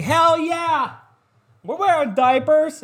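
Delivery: high, loud gleeful cheer; mock cheering girls. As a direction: shout it like proud party girls. Friends cheering in story.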